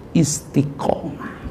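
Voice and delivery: soft breathy whisper; one word, whispered slowly. Acted. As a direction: hush it like a secret. Whispered key word in sermon.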